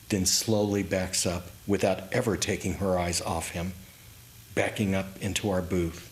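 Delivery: usual speaking voice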